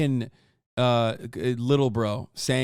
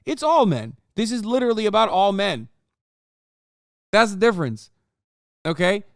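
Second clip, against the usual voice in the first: higher, louder, insisting; heated rant; 5 semitones higher, 4 dB louder than usual. Real, not acted. Streamer arguing with a commenter, loud heated insistence. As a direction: push back hard, raising your voice.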